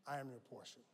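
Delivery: gentle God. Retold or imitated. God gently answering the pleading believer, soft. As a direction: quietly reassure.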